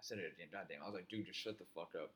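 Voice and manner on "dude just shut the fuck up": hushed warning. Retold or imitated. Hushing friend during cop search.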